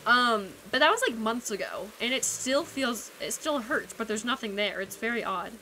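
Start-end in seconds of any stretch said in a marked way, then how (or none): none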